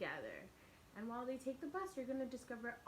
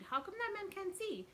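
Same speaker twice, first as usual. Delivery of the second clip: put-on curious child CJ; 9 semitones higher than usual. Child CJ asks grandma.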